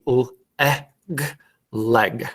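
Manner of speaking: "l, eh, g" apart, then "leg" with short e; careful phonics "leg", vowel as in "bed"; sounding out. Shown as a demonstration. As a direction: segment "leg" with an open "eh", then blend. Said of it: "when really it should be".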